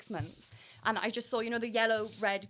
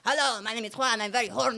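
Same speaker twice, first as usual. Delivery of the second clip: put-on Mexican accent. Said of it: "like, a Mexican coming to Wales".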